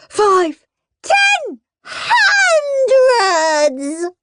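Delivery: put-on high little-child wail, building to the last word. A narrator's reading. Minnie counts pirates for Dad, high building wail. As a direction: wail each bigger number with growing panic. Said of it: "wailed Minnie Minnie".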